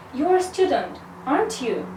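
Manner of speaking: usual speaking voice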